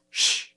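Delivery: short hushing "shh"; curt shush to silence someone; quick, sharp shh; terse shush. A narrator's reading. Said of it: "got a terse".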